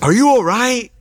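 high, loud imitation of a woman; alarmed girl. Girl checking on her crying passenger, alarmed. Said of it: "so then she's like".

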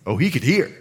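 normal talking voice

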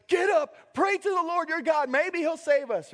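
high, loud shouted plea; frantic sailors. Retold or imitated. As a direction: shout a sleeper awake in desperation. Voicing sailors waking Jonah in storm.

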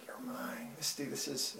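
intimate whisper; God speaking softly. Retold or imitated. God calls Misty as his own, in a whisper. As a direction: whisper it as God's tender claim. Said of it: "God was saying".

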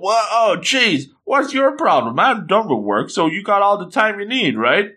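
high, whiny-eager pleading; acted indignant appeal to a friend; taken-aback protest. Hero protests being sent away.

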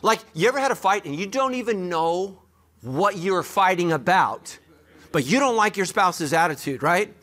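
mock indignant complaining; exasperated. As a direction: sound fed up with your spouse. Acting out a marital spat.

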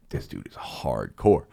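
very slow, low-pitched awe; mock awe. Student admiring his teacher, slow low awe. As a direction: drop low and stretch it out, impressed. Acting his awed reaction.

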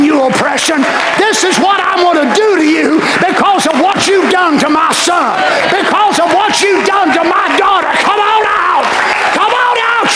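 high, shouted rebuke of the devil; fiery preacher shouting down the devil; preaching at full force. Acted. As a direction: rebuke the devil with all your might.

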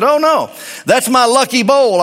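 high, lightly mock-surprised refusal; owner's punchline. Owner refusing the dealer the bowl, high and mock-innocent. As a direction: deliver the shopkeeper's sly punchline up high. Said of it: "And the owner said".

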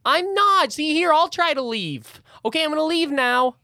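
high, loud flustered snake apologizing; high snake apology. Acted. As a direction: squeak out a nervous sorry.

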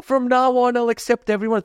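high, slow sing-song vow of being a better person; mock-earnest vow, voice lifted way up; mock saintly resolve. Talking to himself, a mock-saintly high vow. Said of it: "I was like".

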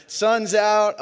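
Sun's out belted high and loud, punch on Suns; catchphrase shout. Shown as a demonstration. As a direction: yell the catchphrase out for the crowd. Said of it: "I just like to say it".

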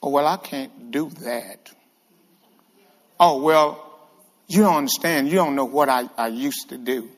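low, downhearted excuse-making; reluctant doubter. Acted. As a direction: imitate a doubter backing off, voice dropped low. Voices a reluctant, doubting believer.

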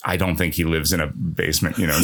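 normal talking voice

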